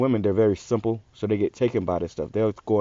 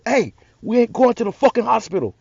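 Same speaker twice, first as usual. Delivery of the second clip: angry refusal; 12 semitones higher than usual. Retold or imitated. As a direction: shut them down hard. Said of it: "cuss their ass the fuck out".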